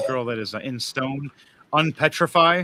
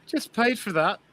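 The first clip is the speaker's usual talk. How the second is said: sad guy's voice; 8 semitones higher than usual. Acted.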